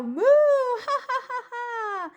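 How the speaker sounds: long moo breaking into high laughter; moo then laughter. Acted. Interrupting cow punchline, moo and high laugh. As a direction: butt in mooing, then crack up.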